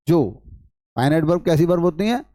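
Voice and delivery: usual speaking voice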